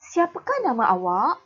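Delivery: rising question tune; question intonation. Shown as a demonstration. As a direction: siapakah nama awak? with a clear rise. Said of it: "Dengar, ya".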